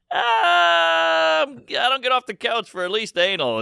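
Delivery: long, high hemming and hawing; mock reluctance. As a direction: drag it out high like a hammed-up holdout. Acted haggling over sexts.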